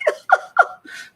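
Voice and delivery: real high-pitched burst of laughter. Real, not acted. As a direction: crack up suddenly. Cracking up at the advice.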